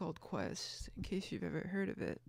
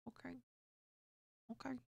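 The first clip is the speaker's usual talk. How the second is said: ordinary talk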